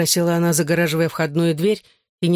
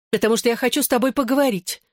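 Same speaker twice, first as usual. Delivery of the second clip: higher, quicker female voice; heated; 7 semitones higher, faster than usual. A narrator's reading. Girl insists on talking to her ex, heated. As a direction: insist eagerly, pitched up and hurried. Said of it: "с жаром заявила она".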